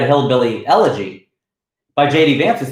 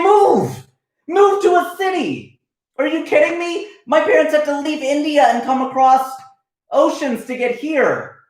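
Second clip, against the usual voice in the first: exasperated shout; 11 semitones higher than usual. Pretend. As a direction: yell it at them like they're being ridiculous. Imagined rant at reluctant movers.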